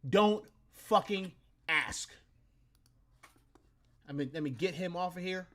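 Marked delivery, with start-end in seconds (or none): none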